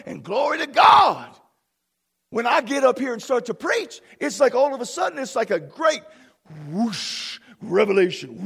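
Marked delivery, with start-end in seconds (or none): 0.1-1.3 loud burst of praise; ringing, triumphant cry; exultant shout. Real, not acted. Climax of the build-up. 6.7-7.4 breathed "whoosh" like rushing wind; mouthed swoosh; whooshing noise. Shown as a demonstration. Imitates rush of revelation.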